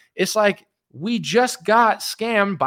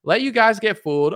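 ordinary talk

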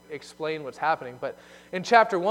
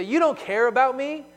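higher, louder, mimicking a sulky teen; huffy teenager; 8 semitones higher, 6 dB louder than usual. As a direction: imitate a teenager accusing a parent. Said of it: "and they say".

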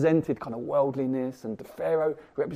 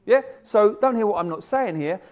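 ordinary talk

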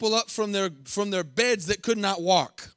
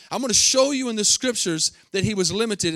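ordinary delivery

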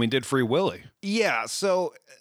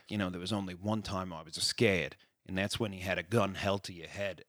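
low, much softer mock-sincere movie line; imitating the hero; 9 semitones lower, 9 dB quieter than usual. Mocking the hero's ending speech, low and soft. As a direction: murmur it low like a cheesy romantic confession. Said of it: "he's like".

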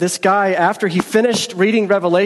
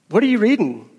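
higher-pitched, swooping question; mock curiosity; 6 semitones higher than usual. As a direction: voice the guys quizzing the janitor, pitched up. Seminarians question janitor in retold story.

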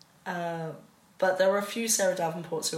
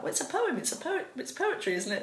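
much higher-pitched retold children's correction; retold puzzled correction; 9 semitones higher than usual. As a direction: pitch it up like kids correcting mum. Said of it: "We were like".

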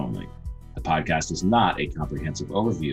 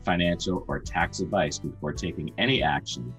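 brisk legal disclaimer read; fast legal disclaimer. Acted. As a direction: rattle off the fine print. Disclaimer read over music.

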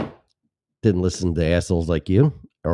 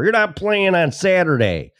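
high-pitched mock coach scolding; exaggerated football coach threat; imitated coach yelling; 10 semitones higher than usual. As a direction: holler a punishment at a player. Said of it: "they yelled at you".

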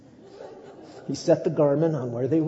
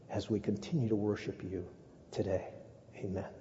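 prayer; 3 dB quieter than usual. Acted. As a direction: pray gently before the congregation. Leads a prayer.

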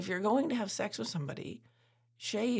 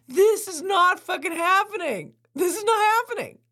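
exasperated outburst; 14 semitones higher, 11 dB louder than usual. Retold or imitated. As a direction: cry out in comic disbelief at the farting. Lying in bed while he farts.

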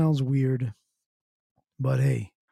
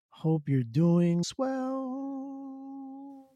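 stretched greeting; 10 semitones higher, 4 dB quieter, much slower than usual. Acted. Drawn-out sign-off greeting to viewers.

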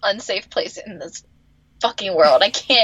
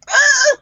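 high, sharp yelp of frustration; frustrated yelp; 6 dB louder than usual. Real, not acted. Cohost fed up with April, frustrated yelp. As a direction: let out a fed-up cry.

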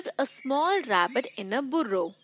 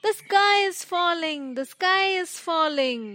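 scared shouting; 7 semitones higher than usual. Acted. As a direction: cry out the danger in a raised pitch.

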